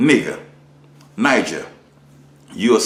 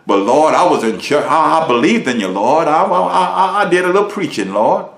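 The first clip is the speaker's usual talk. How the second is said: higher, stammering plea to the Lord; pleading sinner; 6 semitones higher, 4 dB louder than usual. Retold or imitated. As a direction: beg and stumble over the I's. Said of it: "I'm gonna be judged".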